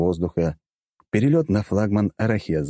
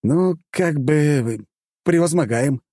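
slow, drawn-out, higher-pitched hedging; hesitant, thinking; 8 semitones higher, slower than usual. A narrator's reading. Hero improvises an answer about the plan, slow hesitant drawl. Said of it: "задумался я".

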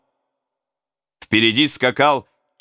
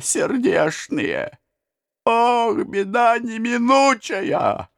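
mournful lament; 10 semitones higher, slower than usual. A narrator's reading. Old peasant laments.

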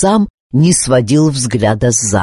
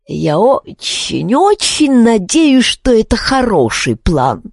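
higher, slow, doubtful mutter; muttering raven; 6 semitones higher than usual. A narrator's reading. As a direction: mutter it, worried and unsure. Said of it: "пробормотал Калибурн". (бормочущий ворон)

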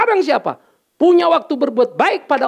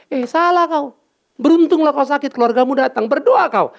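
much higher, firm telling-off; scolding rebuke; 6 semitones higher than usual. Retold or imitated. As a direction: scold him into gratitude. Pastor scolding sick man, retold. (menegur orang sakit dengan nada tinggi)

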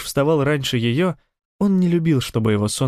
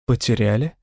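worried, questioning repeat; anxious question. Acted. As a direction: ask it with sudden alarm. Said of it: "тревожно переспросила Сарин".

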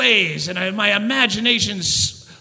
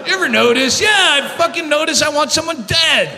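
normal talking voice

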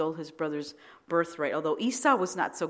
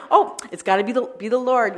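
imitating her own eager self, higher and louder; excited; 6 semitones higher, 12 dB louder than usual. Retelling to the church her sure sign from God, excited. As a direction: sell it as a thrilled divine hunch. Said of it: "I'm like".

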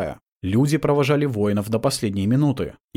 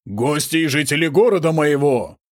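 booming lordly proclamation; god voice; 5 semitones higher, 3 dB louder than usual. Acted. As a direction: thunder it out to the crowd. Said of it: "прогремел голос князя Миши".

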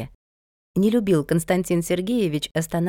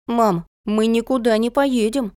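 son's firm protest, pitched higher; lightly voiced stubborn child, higher pitch; firm refusal; 5 semitones higher than usual. A narrator's reading. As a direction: stand your ground with mum. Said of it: "твёрдо сказал сын".

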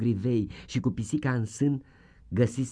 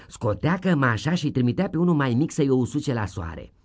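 ordinary delivery, nothing marked

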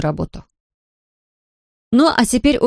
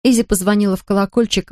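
ordinary delivery

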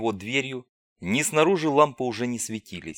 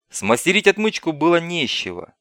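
plain reading voice

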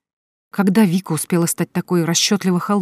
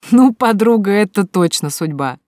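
higher, louder hearty laughing; guffawing; 4 semitones higher, 6 dB louder than usual. A narrator's reading. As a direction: roar it out laughing at her. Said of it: "хохотала Вика".

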